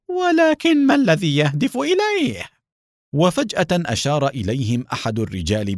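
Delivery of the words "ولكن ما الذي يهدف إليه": very high-pitched, furious question; exasperated rage. A narrator's reading. Haim baffled by his enemy's aim, enraged. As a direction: fume in frustration, voice shooting up. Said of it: "وقال بغيظ".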